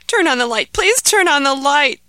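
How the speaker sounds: high, loud frightened plea; begging. Acted. Scared boy begs his parents to turn on the light, high and loud. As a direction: beg for the light to go on. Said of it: "begged Ahmed".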